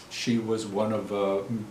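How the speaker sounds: normal talking voice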